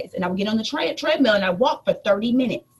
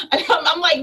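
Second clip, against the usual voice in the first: laughing; 7 semitones higher than usual. Real, not acted. Laughing retelling McDonald's line anger.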